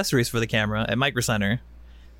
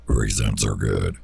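very low, drawn-out joke voice; bass voice; slower than usual. Pretend. Joking with a friend about sound, bass voice. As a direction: drop far down into a slow mock bass. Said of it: "that was my bass voice".

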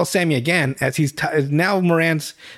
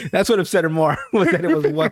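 normal talking voice

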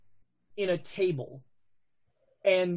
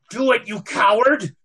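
sharp, louder, higher taunt; taunting yell; 4 semitones higher, 8 dB louder than usual. Acted. Madam Lin yelling at the sobbing man to shoot. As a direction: goad him to pull the trigger. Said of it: "Madam Lin is just looking at him going".

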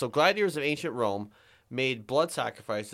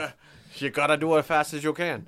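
fast-talking Cajun caricature; quick, goofy Louisiana accent; mock Cajun accent; 3 semitones higher, 5 dB louder, faster than usual. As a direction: rattle it off like a hammed-up Cajun. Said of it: "from friggin' Louisiana".

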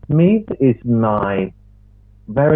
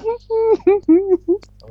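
extremely high, slow put-on squeak; Factual Fanny. Host gives up the character, still in a very high voice. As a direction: push the comic lady voice right up high. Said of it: "that voice".

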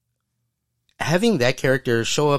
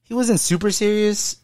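retold protest; 11 semitones higher than usual. Retelling own rebuttal to critics.

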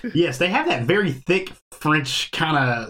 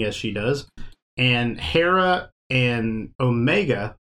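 ordinary delivery

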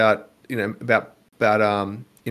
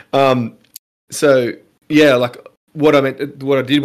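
shouting over fire alarm; 3 semitones higher, 7 dB louder than usual. Real, not acted.

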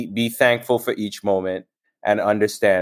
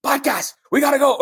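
startled; faster than usual. Retold or imitated. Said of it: "I was like".

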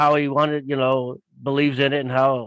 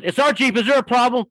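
high-pitched imitation of a confrontational wife; challenging wife; 9 semitones higher than usual. Wife turns and confronts the biker, imitated challenge. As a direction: throw down a challenge in a raised pitch.

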